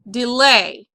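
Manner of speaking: "Delay" with the weight on LAY; "Delay", light first syllable, stressed falling LAY; stress on second syllable. Shown as a demonstration. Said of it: "your accent's gonna go back here".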